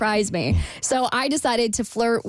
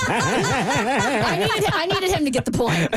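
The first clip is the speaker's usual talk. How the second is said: laughing; 6 semitones higher than usual. Real, not acted. Laughing after her joke.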